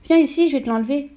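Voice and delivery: voix d'épouse plus aiguë et plus forte, qui gronde; épouse agacée. A narrator's reading. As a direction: gronde ton mari comme une sotte idée. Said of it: "lui dit sa femme". (une femme sermonne son mari pour le chapeau, agacée)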